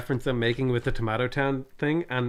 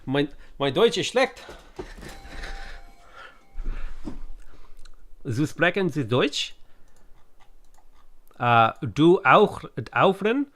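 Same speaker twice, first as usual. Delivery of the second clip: German attempt. Shown as a demonstration. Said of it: "Your German is kinda close to the Dutch".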